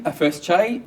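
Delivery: ordinary delivery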